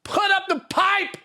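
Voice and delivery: very high, loud screamed order; mock furious yell. Host to nosy apprentice at work, hammed-up furious yelling. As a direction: shriek it like a boss who has snapped.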